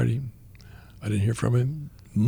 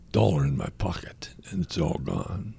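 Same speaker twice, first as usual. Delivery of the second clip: tearful whisper; 5 semitones lower, 5 dB quieter than usual. Retold or imitated. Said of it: "And he's still crying".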